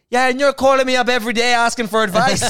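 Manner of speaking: high, loud mock shout; yelled comeback. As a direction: shout it as an imitated argument clincher. Retold public argument, loud comeback.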